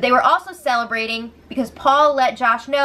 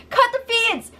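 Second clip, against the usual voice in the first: mock outcry; 12 semitones higher than usual. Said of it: "And then the feeds cut".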